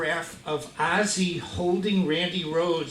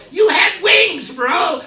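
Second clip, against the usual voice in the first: high, loud shouted protest; hammed-up fan dismay; incredulous; 12 semitones higher, 14 dB louder, slower than usual. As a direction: holler in comic disbelief. Acts out disbelief at small idol.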